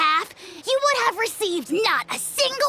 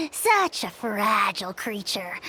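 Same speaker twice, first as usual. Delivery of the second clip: scornful whispered laugh; 6 semitones lower than usual. Acted. Mocking a dead foe.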